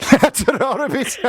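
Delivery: high, drawn-out Brummie farewell through laughter; delighted laughing echo. Retold or imitated. Radio host delighted by caller's Birmingham phrase, laughing echo. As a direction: crack up while echoing the local goodbye.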